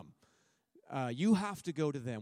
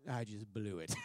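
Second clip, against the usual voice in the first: rueful laughing. Retold or imitated. Retelling his own panicked thought, laughing.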